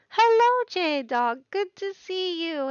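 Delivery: big bright hello to a friend; excited greeting. Real, not acted. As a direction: call out the hello loudly. Greeting viewer loudly on stream.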